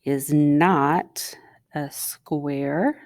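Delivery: "not" drawn out in a slow whisper; stress on not. Shown as a demonstration. Teacher stressing "not" while writing. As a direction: lean on "not", slow and hushed. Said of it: "I often underline the word not just for emphasis".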